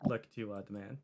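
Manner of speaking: ordinary talk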